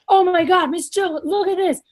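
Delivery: high-pitched gushing sales excitement; imitated enthusiasm. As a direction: imitate a wildly keen rep showing off a product. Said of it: "'cause their enthusiasm is like".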